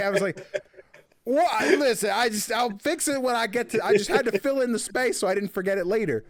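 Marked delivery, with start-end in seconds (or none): 1.2-5.2 high, loud mock defensiveness; defensive protest. Host retells excusing a typo to friends, defensively. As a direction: act flustered and push back.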